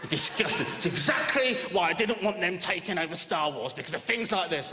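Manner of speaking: lightly voiced disgusted fan; outraged Star Wars fan. Mate complaining about the Mickey Jedi cloak, mildly disgusted. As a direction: sniff it like a snobby purist. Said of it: "One of my mates went".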